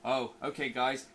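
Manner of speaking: ordinary delivery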